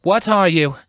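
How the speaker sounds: high, uneasy question; voiced young Alicorn on edge; wary question. A narrator's reading. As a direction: ask the stone, guarded. Twilight questions the shard.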